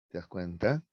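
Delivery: ordinary talk, nothing marked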